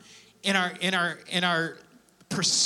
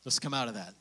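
gentle fatherly God voice; 5 semitones lower, 9 dB quieter than usual. Retold or imitated. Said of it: "He goes".

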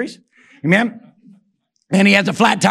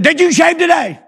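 mock inspector yell; 8 semitones higher, 3 dB louder than usual. Inspector in recruit's face.

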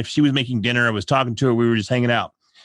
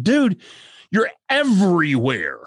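higher, hammed-up teasing complaint; mock exasperation; 5 semitones higher, slower than usual. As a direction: tease a friend who's always around. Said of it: "they're like".

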